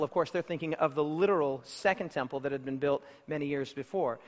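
normal talking voice

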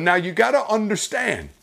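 usual speaking voice

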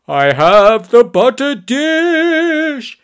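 high, loud, drawn-out heroic cry; screamed proclamation. A narrator's reading. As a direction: proclaim it slowly like a battle cry. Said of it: "he sort of screamed".

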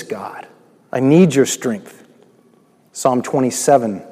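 ordinary talk, nothing marked

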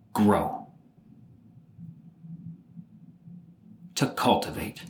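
measured, formal tribute with long pauses; grave, deliberate speech, weighing each phrase; solemn eulogy. Acted. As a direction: deliver a funeral tribute phrase by phrase. Recites his own wished-for eulogy.

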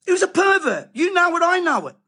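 angry denunciation. Real, not acted. Preacher condemning a celebrated sportsman, angry and high. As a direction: spit out the verdict in disgust.

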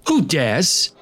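high, loud, hoarse royal outburst; hoarse demand. A narrator's reading. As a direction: bark it out like an enraged prince. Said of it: "he demanded hoarsely".

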